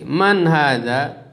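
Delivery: very slow "Man hadza" rising at the end; slow model reading. Shown as a demonstration. Teacher slowly asks "Man hadza" for class repetition. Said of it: "Coba diikuti".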